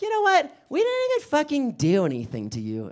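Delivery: mock-huffy comeback; indignant customer. Customer snaps back.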